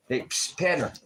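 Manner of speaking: hiss, then a high loud call of the name; psst then call. Real, not acted. Owner hissing then calling Panther, rising to a high loud call. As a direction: get the dog's attention sharply.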